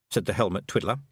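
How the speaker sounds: usual speaking voice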